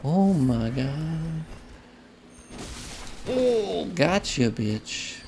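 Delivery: high drawn-out gasps of delight; shrieking jackpot winner. Retold or imitated. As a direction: squeal it like you just won big. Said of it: "you can go".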